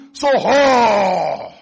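long, drawn-out loud bellow; exaggerated intimidation. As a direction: stretch it into a big, slow roar. Devil magnifying a problem to scare.

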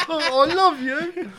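high, loud, slow gush through a laugh; overjoyed, hammed-up thanks; gushing mock delight. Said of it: "And I'm like".

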